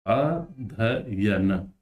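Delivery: अध्ययन split slowly into syllables, a-dhya-yan; slow syllable demo. Shown as a demonstration. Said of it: "अध्ययन होता है".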